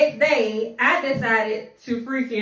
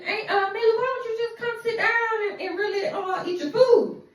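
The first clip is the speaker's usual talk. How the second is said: much higher, sweet coaxing auntie; Maggie's voice; 10 semitones higher than usual. Retold or imitated. Aunt urging her niece to sit and eat, high coaxing voice. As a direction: coax her gently, pitched way up. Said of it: "So Maggie said".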